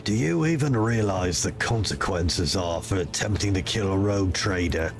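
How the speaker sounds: loom over him with cold authority; stern commander voice. Acted. Player character voice.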